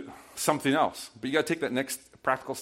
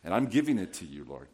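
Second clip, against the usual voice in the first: soft, low, hushed prayer to the Lord; quiet prayer; 6 semitones lower, 7 dB quieter than usual. Retold or imitated. Pastor models a quiet prayer to God, soft and hushed. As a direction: pray it gently, almost under your breath.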